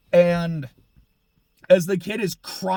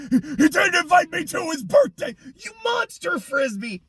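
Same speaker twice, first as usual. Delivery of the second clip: mock anime outburst; 10 semitones higher than usual. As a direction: parody a whiny cartoon character. Parodying an anime line.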